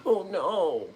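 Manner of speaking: ordinary talk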